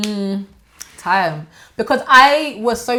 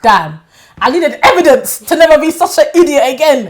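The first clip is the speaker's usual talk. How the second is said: mock self-scolding; 8 semitones higher, 11 dB louder than usual. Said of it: "be like".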